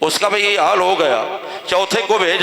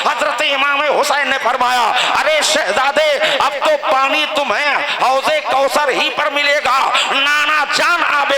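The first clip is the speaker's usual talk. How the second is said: raised, high-pitched pulpit declamation; impassioned preaching; 6 semitones higher than usual. Acted. Preacher voices nephew and uncle before the last battle, impassioned preaching. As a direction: carry the dialogue in a ringing preacher's pitch. Preaches the dialogue at full force.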